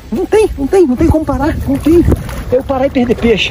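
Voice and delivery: high, rushed and thrilled; can't contain the rush; giddy high-pitched chatter; breathless excitement. Real, not acted. Fish keep biting, can't stop.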